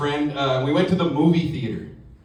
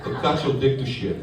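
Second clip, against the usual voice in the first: Russian coworker; 2 semitones lower than usual. Acted. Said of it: "I work with a guy from Russia".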